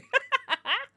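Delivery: sharp high laugh burst; high squeal of laughter. Real, not acted. Streamer laughing at chat's Boston joke, squealing.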